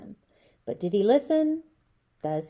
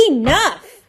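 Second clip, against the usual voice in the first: loud stern shout; 6 semitones higher, 12 dB louder than usual. A narrator's reading. Grandma shouting to stop the children's fight. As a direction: shut the whole noisy table up. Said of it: "Grandma shouted".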